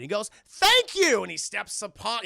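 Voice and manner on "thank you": high, loud, triumphant thank-you; gleeful acceptance. Retold or imitated. Invited vampire thanks the boy at the door, gleeful. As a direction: burst out with delighted thanks.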